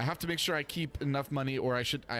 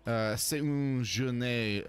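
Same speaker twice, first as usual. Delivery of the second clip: put-on French accent; 5 semitones higher, much slower than usual. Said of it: "I do such a great French accent".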